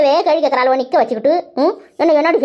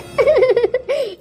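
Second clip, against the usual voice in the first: high scornful cackle, short and falling; mocking laugh; 9 semitones higher than usual. A high mocking cackle at the crying sisters.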